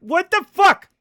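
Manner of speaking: loud, high startled what the fuck; blurting it out in shock; startled shout. Real, not acted. Streamer reacting to game mishap, startled loud shout.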